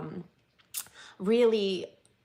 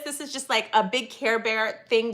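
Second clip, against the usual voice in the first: usual speaking voice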